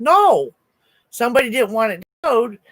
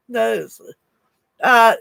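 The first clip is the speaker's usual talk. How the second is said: ordinary talk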